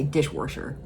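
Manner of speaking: "dishwasher" said "dishworsher" with an r; Wisconsin r. Shown as a demonstration. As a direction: slip an r into "dishwasher". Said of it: "I say".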